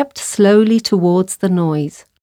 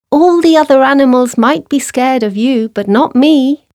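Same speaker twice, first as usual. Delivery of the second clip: brave little mouse; 6 semitones higher than usual. A narrator's reading. Mouse boasting to sleeping lion, high brave voice. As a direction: boast like a plucky mouse, pitched up.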